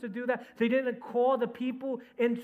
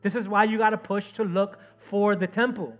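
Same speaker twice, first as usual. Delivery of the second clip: loud, emphatic sermon delivery; fervent preaching; 3 semitones higher, 8 dB louder than usual. Real, not acted. Preacher urging people to look for the temple, fervent. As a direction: preach it hard, punching the key words.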